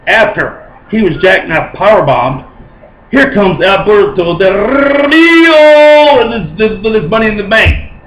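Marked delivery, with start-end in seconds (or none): 3.1-7.9 higher, drawn-out hype building up; slow, rising sportscaster build; excited. Real, not acted. Fan recapping the surprise cash-in, excited.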